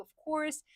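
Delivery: "of course" pitched high with the R sounded; high, rhotic "course"; American R. Shown as a demonstration. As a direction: lean on the R in "course". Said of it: "like, the R's".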